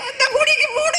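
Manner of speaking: high tearful wail; sobbing cry. Acted. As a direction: cry out you're leaving. Wife leaving in tears.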